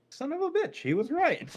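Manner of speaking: high astonished swear; shocked. Acted. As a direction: blurt out in disbelief. Acted guy shocked.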